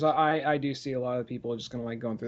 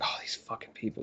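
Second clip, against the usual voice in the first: muttered under breath; 4 semitones lower, 12 dB quieter than usual. Retold or imitated. Muttering in disgust.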